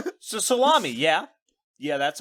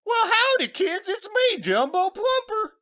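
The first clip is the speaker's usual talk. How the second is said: hammed-up cowboy character; 12 semitones higher than usual. Introducing the made-up character Jumbo Plumper in a high cowboy voice. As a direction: greet the kids like a goofy cowboy star. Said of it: "That's a great character name".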